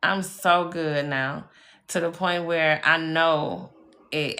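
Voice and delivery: ordinary delivery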